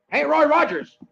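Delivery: high, loud call across camp. Acted. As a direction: shout it from afar. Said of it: "one of the men calls".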